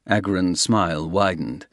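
ordinary talk, nothing marked

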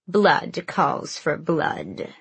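very slow rasping hag voice; rasping old crone. Acted. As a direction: rasp it out like an ancient witch. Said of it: "the Yellowlegs crone rasped".

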